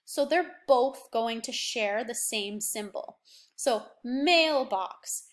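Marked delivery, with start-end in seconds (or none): 3.6-5.2 mailbox stretched out slowly, each sound held so the long A is clear; slow syllable demo. Shown as a demonstration. Teacher to pupils, sounding out a word slowly. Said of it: "that sounds to me like the A".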